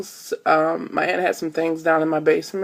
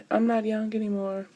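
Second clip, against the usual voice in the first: soft sighing lament, much quieter; mock sad sigh; 4 semitones higher, 9 dB quieter than usual. Lamenting to viewers she is old, soft sigh. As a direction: breathe out a mock-tragic sigh.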